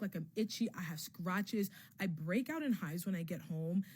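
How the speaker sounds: ordinary delivery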